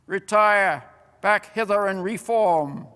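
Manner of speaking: loud rallying shout to the troops; distant call. A narrator's reading. Commander calling his troops back. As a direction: bellow it across the field. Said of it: "calling out from the distance".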